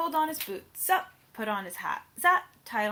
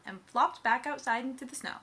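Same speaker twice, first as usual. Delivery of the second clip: plain reading voice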